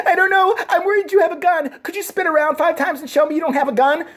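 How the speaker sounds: mock nervous teen girl, pitched high; imitating the decoy girl. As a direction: play the scared teen decoy, hammed up. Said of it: "she's like".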